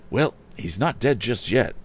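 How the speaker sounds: relieved exclamation. A narrator's reading. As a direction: blurt it out in relief. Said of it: "exclaimed the youth with a sigh of relief".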